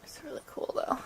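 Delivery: soft sick whisper; breathy, barely voiced. Real, not acted. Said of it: "Don't mind my terrible sick day".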